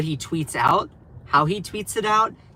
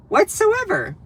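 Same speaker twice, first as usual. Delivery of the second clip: high, exasperated echo; incredulous. Real, not acted. Streamer mocking the critics, high and incredulous. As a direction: repeat it in disbelief. Mocking repeat of own phrase.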